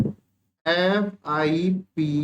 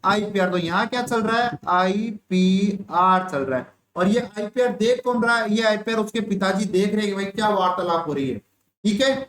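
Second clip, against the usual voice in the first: ordinary talk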